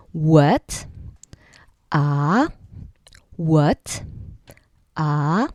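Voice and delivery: "What? Ah" with the pitch rising at the end; second tone demo. Shown as a demonstration. Teacher showing the rising second tone on a questioning what. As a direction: ask "what" so the tone climbs. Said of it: "goes up a little bit, like people asking".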